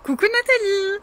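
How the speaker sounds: bright, high call to a newcomer; excited greeting. Real, not acted. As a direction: sing out the hello. Greeting a viewer joining live. (salut joyeux)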